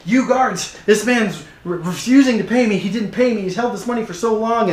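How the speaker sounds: high, loud, indignant accusation; calling the guards. Acted. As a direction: call out for guards to seize him. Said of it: "he then calls the guards over".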